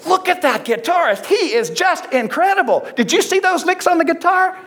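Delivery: high, gushing admirer's mock voice; gushing fan. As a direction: play a starstruck fan raving about the guitarist. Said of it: "so you can say".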